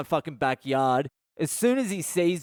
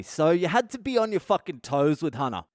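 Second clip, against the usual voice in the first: ordinary delivery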